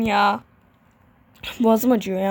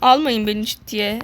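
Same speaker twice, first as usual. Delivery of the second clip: normal talking voice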